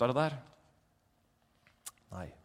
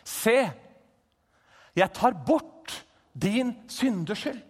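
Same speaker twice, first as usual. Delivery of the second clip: higher, louder, slow forgiving proclamation; voice of God; 7 semitones higher, 7 dB louder, slower than usual. Acted. God speaking to Josva, slow and proclaiming. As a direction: announce forgiveness with weight. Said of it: "Kommer en stemme".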